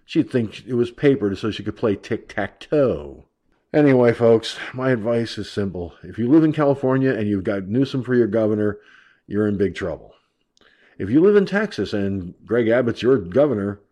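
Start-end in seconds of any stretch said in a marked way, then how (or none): none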